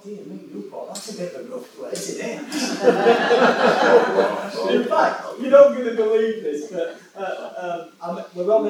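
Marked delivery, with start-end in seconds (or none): 0.0-2.4 hushed, quickened aside to himself; private thought aloud. Retold or imitated. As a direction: say it very softly, as if only thinking it. Voices his private thought about Newport.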